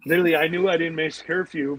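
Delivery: ordinary talk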